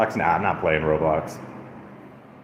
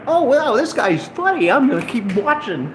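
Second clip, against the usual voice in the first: mock viewer; 5 dB louder than usual. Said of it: "people are gonna be like".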